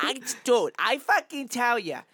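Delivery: slow, hammed-up New England townie; high, drawling mock New England bragging; New England accent. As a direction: drag the words out in broad New England accent. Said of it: "Speaking of New England".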